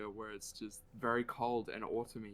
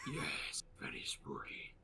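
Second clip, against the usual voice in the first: hushed, playful spooky whisper; breathy, voiceless aside for spooky effect; 9 dB quieter than usual. Pretend. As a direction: whisper it as mock-eerie agreement. Playful spooky greeting, whispered reply.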